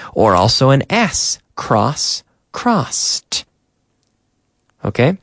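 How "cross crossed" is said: crossed ending in a breathy, crisp t; T sound ending. Shown as a demonstration. Teacher drilling the past tense for learners, crisp final t. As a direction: hiss crossed out with a clear final t. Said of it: "we use the T sound".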